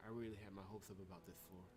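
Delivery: soft, low, uneasy in-character reading; game protagonist voice. Acted. Player voicing the heroine exploring a frozen floor, soft and uneasy. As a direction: voice the wary hero exploring the floor.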